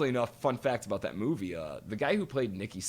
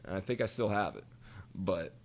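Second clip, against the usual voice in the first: ordinary talk, nothing marked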